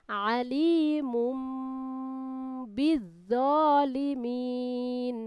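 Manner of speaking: আলিমুম বিজ্জলিমিন drawn out slowly, with nasal sounds held; slow recitation. Shown as a demonstration. Teacher modelling the whole phrase slowly for a student. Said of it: "ইকলাবটা এভাবে পড়তে হয়".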